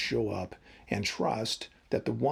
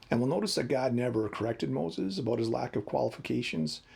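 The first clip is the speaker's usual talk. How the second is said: ordinary delivery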